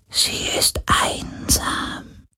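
very soft, slow whisper; sorrowful ghostly whisper. A narrator's reading. Ghost on lonely Helix.

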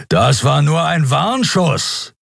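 slow, high, forceful warning; stern clarification. A narrator's reading. Captain's projection addresses the fleeing ship, stern warning. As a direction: make the threat clear, drawing it out. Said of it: "stellte Srons Abbild im Geisterkubus klar".

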